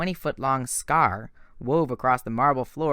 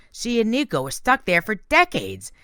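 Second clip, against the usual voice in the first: Grover voice; 10 semitones higher, 4 dB louder than usual. Acted. Grover explaining excitedly.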